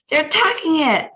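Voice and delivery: high, loud alarmed cry. Acted. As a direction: shout the alarm. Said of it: "cried Charlie".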